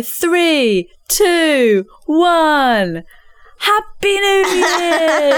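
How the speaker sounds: high, stretched countdown shout; countdown call. Pretend. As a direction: hype the crowd for midnight. Fake countdown on stage.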